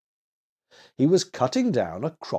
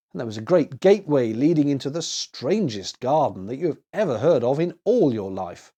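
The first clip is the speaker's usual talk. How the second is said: usual speaking voice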